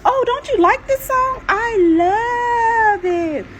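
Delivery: high, loud sing-song innocence, love stretched slowly; mock-innocent taunt; fake innocent. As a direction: play dumb, sweetly defiant. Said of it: "But I was being real serious".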